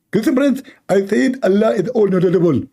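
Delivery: squeaky mock lecturer; mocking apologist. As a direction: play the know-it-all defender, voice pushed up.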